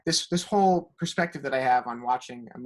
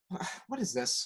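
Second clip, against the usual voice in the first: soft, baffled groan; groaned disbelief; 3 semitones higher, 8 dB quieter than usual. Retold or imitated. Viewer reacting to the dance scene, groaned disbelief. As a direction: groan it out quietly, as if at a loss. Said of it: "You, you just groaned".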